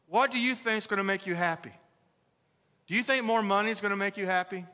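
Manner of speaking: normal talking voice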